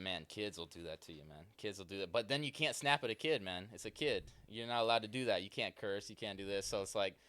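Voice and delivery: normal talking voice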